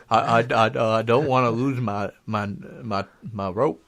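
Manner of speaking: stammering Southern simpleton; Forrest Gump. Acted. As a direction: fret haltingly, tripping over small words. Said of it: "And then Forrest Gump".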